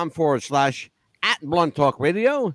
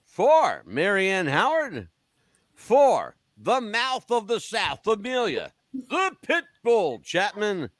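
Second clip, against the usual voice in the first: radio sign-off; 4 semitones higher than usual. Acted. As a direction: sign off the show like a DJ. Show sign-off with nicknames.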